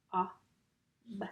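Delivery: K-A-B as three separate letter sounds; sounded out. Shown as a demonstration. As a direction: tap out cob one sound at a time. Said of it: "Let's tap cob".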